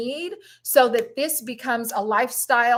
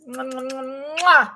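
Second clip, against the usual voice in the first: blown kisses. Real, not acted. Host sending love to her sisters at sign-off, blown kisses. Blowing kisses to viewers signing off.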